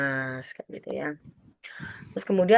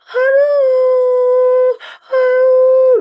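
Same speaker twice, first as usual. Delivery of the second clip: much higher, loud aduh cries of pain; 14 dB louder, much slower than usual. Acted. Sick child crying out to mother, loud high pained wail. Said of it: "ada kasus yang anak sakit".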